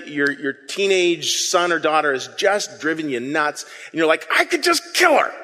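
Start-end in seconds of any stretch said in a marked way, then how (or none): 4.3-5.3 high, strained mock exasperation; exasperated parent. Fed-up parent venting about a teenager, high mock exasperation. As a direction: blurt it like a fed-up parent half joking.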